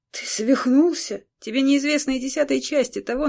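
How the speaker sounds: громко, выше и быстро; выкрикни в негодовании; возмущённо. Acted. Аксель спорит с королём. (гневный выкрик)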